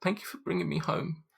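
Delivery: put-on high, soft child's voice thanking her rescuers; a quiet, high child saying thank you; little girl's voice. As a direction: speak as a small grateful girl, high and soft. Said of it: "and says to you".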